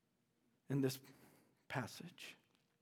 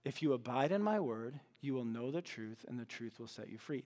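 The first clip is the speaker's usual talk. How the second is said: hushed repeat; 4 semitones lower, 6 dB quieter than usual. Shown as a demonstration. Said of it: "Look at the simplicity".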